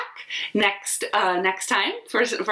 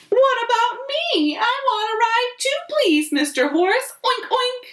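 very high whispery pig pleading, then oinks; very high little pig voice. Acted. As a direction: squeak out a plea not to be left out. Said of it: "says Pig".

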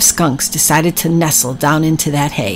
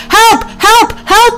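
high, loud cries for help; a little animal's frantic calls for help; shouting for help; 4 dB louder, slower than usual. Acted. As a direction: yell it out in a panic, again and again. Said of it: "he shouted".

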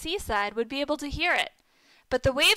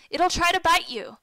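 raised voice, higher and louder; urgent yelled warning; 6 semitones higher, 5 dB louder than usual. A narrator's reading. Narrator as boy warning Michael about the sea lion, raised urgent warning. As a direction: warn him off urgently. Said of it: "I yelled".

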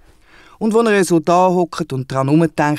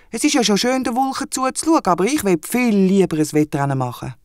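much higher, keen little wombat voice; eager wombat; 7 semitones higher than usual. Acted. The wombat tells Henry he would rather race, high and eager. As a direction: squeak it out, itching to race.

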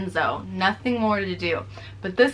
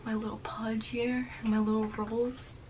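soft teasing whisper about her belly; hushed self-mocking; whispered tease; 8 dB quieter than usual. Real, not acted. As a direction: whisper it playfully to the camera. Teasing own belly softly.

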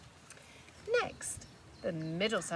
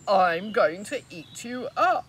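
much louder hungry troll; roaring troll; 13 dB louder, slower than usual. Acted. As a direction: threaten with a roar. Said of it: "roared the troll".

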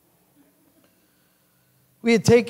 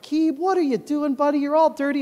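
fond scolding; 10 semitones higher, 3 dB louder than usual. Acted. Owner fussing at his dirty dog.

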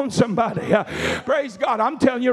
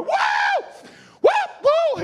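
shouting whoop; slower than usual. Retold or imitated. Preacher imitating the deacon shouting in the foyer, high whoops. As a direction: whoop "Woo!" high, like a man shouting in church. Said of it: "He's shouting in the foyer".

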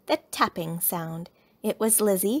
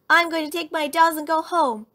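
high, loud huffy announcement; cross cub's parting shout; angry shout; 8 semitones higher, 6 dB louder than usual. A narrator's reading. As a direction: storm off loudly, pitched up. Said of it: "shouted Sister".

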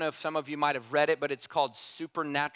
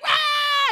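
high loud imitated baby wail; imitating a newborn's cry; 9 dB louder than usual. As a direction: wail like a just-born baby. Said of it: "the baby's like".

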